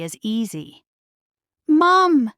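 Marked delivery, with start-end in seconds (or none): 1.7-2.3 high, loud cry of alarm; startled yell as the arrow lands. A narrator's reading. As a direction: shout for your mother in fright. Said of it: "Merida cried".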